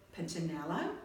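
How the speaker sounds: ordinary delivery, nothing marked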